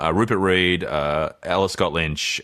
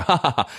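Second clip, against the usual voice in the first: higher, polite forced "ha ha ha"; fake laugh; 7 semitones higher than usual. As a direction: laugh along as if you got the joke. Fake social laugh in a retold encounter.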